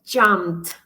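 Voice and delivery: "jumped" with -ed as a final t; ending said as T. Shown as a demonstration. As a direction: end "jumped" on a t sound, in one syllable. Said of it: "it sounds at the end like a T".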